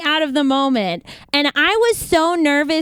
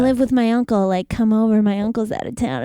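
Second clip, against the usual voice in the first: lightly voiced guy; 7 semitones lower than usual. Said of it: "he's like".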